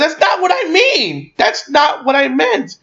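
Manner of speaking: high, drawn-out mock protest; hammed-up dismayed objection; mock scolding. Acting Deadpool scolding the cabbie.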